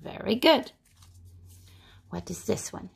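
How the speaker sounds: ordinary delivery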